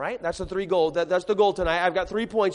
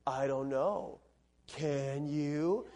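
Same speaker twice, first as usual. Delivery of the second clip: low, soft, dry sarcastic reply; sarcastic mom; 9 semitones lower, 12 dB quieter, much slower than usual. Acted. Mom answers kid asking to go outside, sarcastic. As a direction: imitate a mother's pointed, low-voiced sarcasm. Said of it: "heard a mom get sarcastic with their kids".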